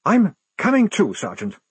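high, emphatic officer, dropping at the end; insistent. A narrator's reading. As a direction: insist on joining in. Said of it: "Blouse insisted".

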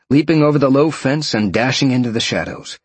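usual speaking voice

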